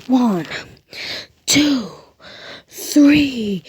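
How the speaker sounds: high, loud referee count; shouted pin count. Acted. Deku pinning the man, shouting the count.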